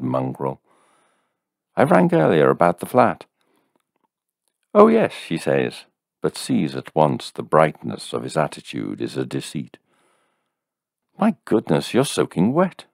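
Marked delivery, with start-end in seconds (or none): 1.8-3.3 polite caller. A narrator's reading. Caller voiced at the door. 4.7-5.1 put-on high, bright older woman; a hint of a chirpy landlady; lightly voiced landlady. A narrator's reading. Landlady answering the door, lightly voiced higher. 11.2-12.9 higher, fussing woman; lightly voiced landlady. A narrator's reading. Landlady seeing the soaked visitor, mildly fussing.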